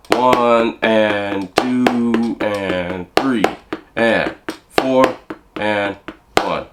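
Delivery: very slow, stretched counting with long gaps. Shown as a demonstration. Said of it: "a little slower".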